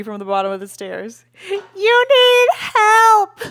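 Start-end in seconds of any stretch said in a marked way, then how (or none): 1.5-3.4 high, loud, drawn-out screech; screeched yell. Retold or imitated. Sister screeching up the stairs after a fight, high loud screech. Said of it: "she yelled, she screeched at me".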